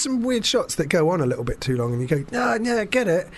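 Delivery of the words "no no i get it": higher, mock-impatient no no; impatient brush-off. Viewer hurrying a lingering shot along, mock impatient. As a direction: wave off a shot that drags on.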